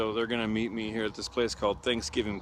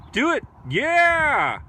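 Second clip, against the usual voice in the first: very high, loud cheer; cheering loudly; 14 semitones higher, 8 dB louder, much slower than usual. Real, not acted. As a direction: whoop them on like a crowd. Cheering goats on.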